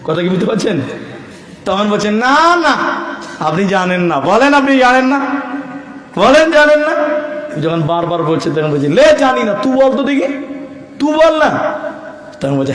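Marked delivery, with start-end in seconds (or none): 2.1-11.5 mock argument that builds up; insistent back and forth.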